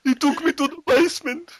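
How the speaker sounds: high, slow hammed-up whine; mock Boris lament. Boris telling the TV host, high and whining. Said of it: "Boris on TV".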